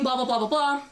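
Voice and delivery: ordinary talk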